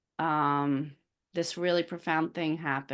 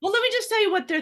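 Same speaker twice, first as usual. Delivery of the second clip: imagined critic; 13 semitones higher, 6 dB louder, faster than usual. Acted. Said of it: "Anyone who's listening to this right now is thinking".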